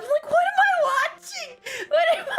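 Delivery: high, laughing question; laughing disbelief. Real, not acted. Recalling the strange art film, laughing. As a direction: ask it while cracking up.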